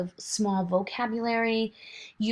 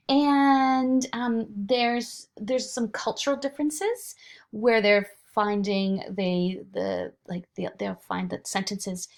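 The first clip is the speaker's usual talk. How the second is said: ordinary talk, nothing marked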